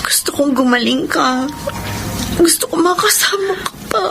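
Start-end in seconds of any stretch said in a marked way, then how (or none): 2.4-4.0 tearful plea; crying. Acted. Begging a dying friend to stay, crying. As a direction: plead through tears, wanting more time. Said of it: "Huwag ka nang umiyak".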